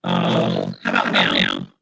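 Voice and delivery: garbled, deep glitchy voice on a bad call; broken-up robotic audio; robotic distortion. Real, not acted. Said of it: "It's still the same".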